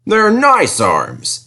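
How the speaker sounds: mildly offended. A narrator's reading. Harold answers Percy, slightly offended. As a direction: defend yourself a bit stung.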